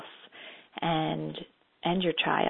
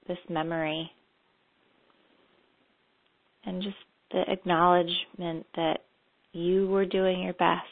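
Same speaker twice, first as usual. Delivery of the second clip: calm, unhurried meditation guide; guided meditation. Acted. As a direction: lead them gently into memory.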